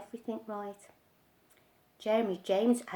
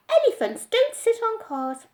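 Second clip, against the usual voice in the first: higher, louder mock mother; boy mocking his mother's line, higher; mimicking mother; 10 semitones higher, 6 dB louder than usual. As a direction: parrot her words.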